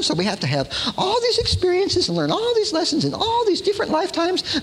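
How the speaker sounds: high, sing-song lecturing voice; mock Shirley MacLaine. Preacher mocking Shirley MacLaine's reincarnation teaching, high sing-song. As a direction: lampoon her lessons talk. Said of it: "She says".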